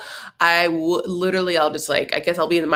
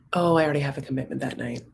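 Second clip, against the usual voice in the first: "night" falls firmly at the end, full stop; quick, final, no apology in the tune; falling-tone decline; 5 semitones lower, 6 dB quieter, faster than usual. Shown as a demonstration. Demoing a firm decline to a friend, voice dropping to a period. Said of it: "got my intonation to go down at the end".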